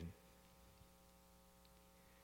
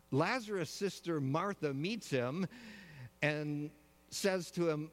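ordinary delivery

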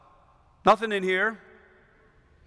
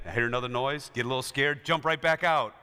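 ordinary talk, nothing marked

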